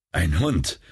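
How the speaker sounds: usual speaking voice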